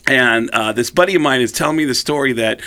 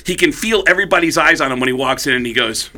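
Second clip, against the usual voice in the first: ordinary delivery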